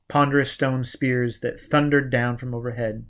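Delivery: ordinary delivery, nothing marked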